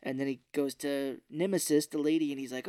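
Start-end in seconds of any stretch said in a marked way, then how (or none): none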